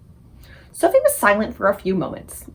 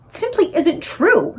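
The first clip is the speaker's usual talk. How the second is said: slow, high, cross protest; angry cry; 9 semitones higher, slower than usual. Acted. Sophie angrily contradicts the BFG, slow and high. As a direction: snap back in outrage. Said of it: "Sophie cried angrily".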